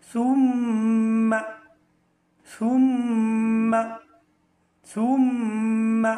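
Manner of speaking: সুম্মা stretched very slowly and loud, rounded lips; drawn-out demo. Shown as a demonstration. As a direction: hold every sound of সুম্মা long and strong. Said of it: "দুই টুট গুল হয়ে যাবে এভাবে". (ঠোঁট গোল করে খুব টেনে জোরে সুম্মা)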